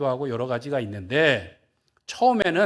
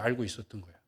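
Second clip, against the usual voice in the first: softer repeat; 6 semitones lower, 12 dB quieter, faster than usual. Acted. Preacher repeats the point to the congregation, hushed. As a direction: drop to a near hush.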